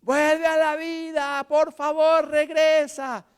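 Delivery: high, loud, slow begging; mock pleading wail. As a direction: beg the dead to come back. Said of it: "le suplicaban al muerto que reviviera". (súplica aguda y fuerte)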